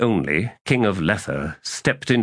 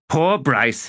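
higher, emphatic repeat of pity; mocking echo; 6 semitones higher than usual. A narrator's reading. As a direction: throw it back high and scornful. Tehol throws the pity back.

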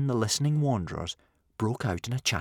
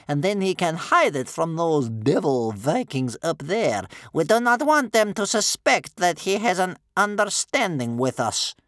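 high, loud grandmother voice; commanding old grandmother; 10 semitones higher, 7 dB louder than usual. Acted. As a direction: instruct the helpers loudly.

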